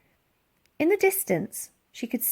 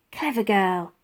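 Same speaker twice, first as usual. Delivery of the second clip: ordinary delivery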